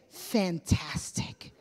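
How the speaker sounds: slow, soft, drawn-out "Fantastic" with a laugh; mock American reply. Preacher mimicking an American's upbeat answer, slow and soft with a laugh. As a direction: lightly send up a breezy American answer. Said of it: "you ask an American".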